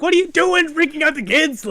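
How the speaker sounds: high, loud retold scolding; imitated angry father yelling; mad dad. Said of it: "gets mad at my mom".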